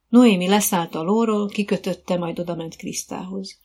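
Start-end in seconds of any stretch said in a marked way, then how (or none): none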